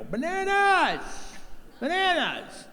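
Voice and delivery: high, slow drawn-out call for help; helpless high wail for staff. Retold or imitated. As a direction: yell across the store, stretching it out. Calling out for help at checkout.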